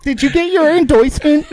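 high-pitched mock "en-doysment" question; teasing, high mimicry; mocking imitation. Mocking the host's slip.

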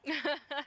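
forced awkward laugh; nervous laugh. Real, not acted. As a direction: laugh it off uneasily. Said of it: "le rire jaune".

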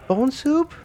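jumps high on bone soup, unsure; mock high-pitched bewildered Claire; Claire's high question. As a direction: squeak it out as a timid question. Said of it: "Claire just goes".

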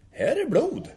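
very high, loud imitated yell; sudden excited cry. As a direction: burst out with a startling discovery call. Benny ropar plötsligt vid fyndet. (härmat plötsligt utrop)

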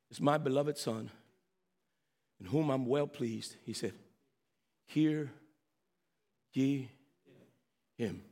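low, soft, with long pauses; hushed scripture quote. Retold or imitated. Preacher quotes God to the church, hushed and low.

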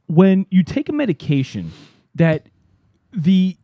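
normal talking voice

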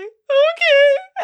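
high, loud wailed okay; loud crying okay. Retold or imitated. Telling the pharmacist she is okay while sobbing, high loud wail. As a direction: wail it through tears. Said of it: "And I'm, like, full on crying".

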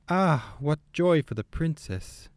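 high, drawn-out cry of joy; delighted exclamation. A narrator's reading. Frenchwoman rushes out to greet the princess, delighted exclamation. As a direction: gush it slowly, pitched well up. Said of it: "exclaimed she".

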